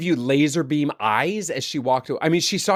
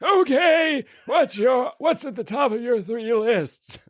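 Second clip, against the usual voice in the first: much higher, slow put-on voice; much higher mock-refined question; mock posh voice; 9 semitones higher, slower than usual. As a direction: tease him in a lofty put-on voice. Teasing the cohost in a put-on voice.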